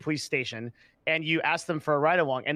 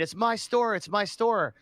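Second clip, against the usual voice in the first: retold scream; 6 semitones higher, slower than usual. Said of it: "the guy's screaming".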